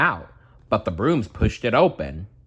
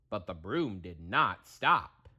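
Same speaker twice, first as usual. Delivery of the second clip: plain reading voice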